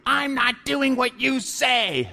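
high, slow mock tantrum; defiant little boy. Little boy refusing his mom in a restaurant, mock tantrum. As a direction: play a kid throwing a fit, high-pitched and drawn out. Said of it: "absolutely throwing a fit and losing his mind".

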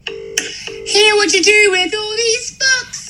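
high, slow, hammed-up mock voice; silly high voice repeating the pickup line; put-on silly voice.

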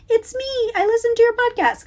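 host mimics a new listener saying hi; imitating a listener. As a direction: squeak it like a shy fan peeking out. Voicing shy listeners.

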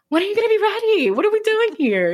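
high-pitched, hurried pestering; playfully pleading, pitch way up; mock impatience. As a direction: push for an answer, comically exasperated. Acting own impatient pushing of partner.

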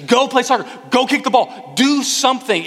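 high, urgent sideline orders; forceful command. Acted. Soccer parent ordering a kid to play, forceful. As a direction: drive each order home, pitched well up. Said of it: "there's a force to the command".

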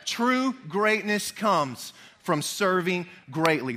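"True greatness comes from serving greatly" spoken very slowly for all to echo; slow, measured congregational line; slow call to repeat. Shown as a demonstration. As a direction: lead the room through it word by word. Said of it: "Can you say that with me?".

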